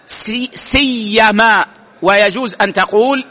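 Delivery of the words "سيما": لا سيما slow, the ي doubled; teacher models لا سيما with a geminated ي; pronunciation demo. Shown as a demonstration. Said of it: "التشديد والتخفيف". (لا سيما بتشديد الياء)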